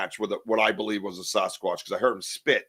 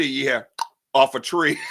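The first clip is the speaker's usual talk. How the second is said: ordinary delivery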